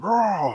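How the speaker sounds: loud, high growling roar; Hulk roar. Acted. Hulk objects to being called monster, roaring. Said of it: "And Hulk said".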